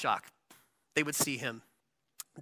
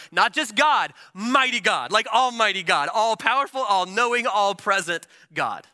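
building proclamation; 5 semitones higher, 5 dB louder than usual. Acted. Preacher builds a list of titles.

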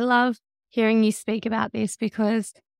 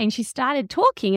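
normal talking voice

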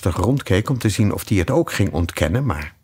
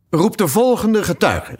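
king; 11 semitones higher, 3 dB louder than usual. Acted. King calls a witness.

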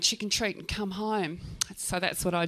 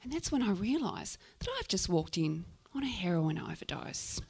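hushed realisation; 2 semitones lower, 7 dB quieter than usual. Retold or imitated. Storyteller realising she walked in on an overdose, hushed realisation. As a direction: let the shock sink in quietly.

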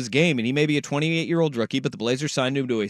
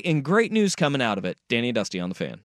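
ordinary talk, nothing marked

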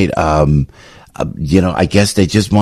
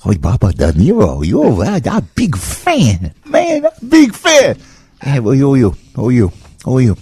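Japanese accent impression. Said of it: "that's an impression".

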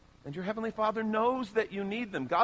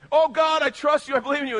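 high, loud, overblown praise; mock fervent devotion; 9 semitones higher, 10 dB louder than usual. Preacher mimicking shallow believers, high and loud. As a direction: ham up eager faith. Mocking our shallow trust.

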